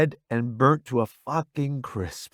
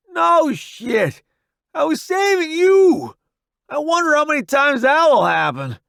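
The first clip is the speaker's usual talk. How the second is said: loud, high, pained yell; exasperated shout; 14 semitones higher, 6 dB louder than usual. A narrator's reading. Wounded Roddy answering the friend he saved, exasperated shout. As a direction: shout back in irritation.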